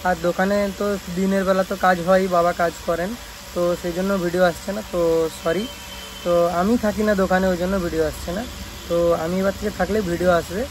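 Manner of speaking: normal talking voice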